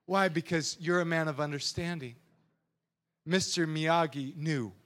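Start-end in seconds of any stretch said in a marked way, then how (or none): none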